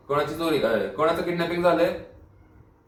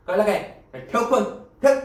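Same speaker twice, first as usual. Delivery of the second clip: high, raised angry command; angry dismissal; 6 semitones higher, 4 dB louder than usual. Acted. Inspector ordering the goat's owner to hang up, angrily. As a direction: order the caller off the line.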